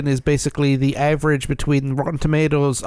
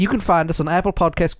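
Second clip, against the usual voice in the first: ordinary delivery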